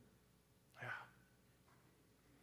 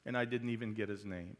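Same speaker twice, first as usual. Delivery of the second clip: dramatic poem reading; 4 dB louder than usual. Acted. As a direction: read the poem like a plea, fighting not to choke up. Reads a poem aloud.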